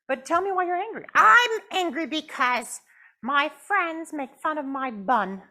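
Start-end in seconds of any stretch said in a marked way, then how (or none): 1.1-5.4 high, slow, louder pouty puppet; pouting puppet. Acted. Puppet Jasmine tells the counselor she is mad, pouting high. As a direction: stretch out the sulk.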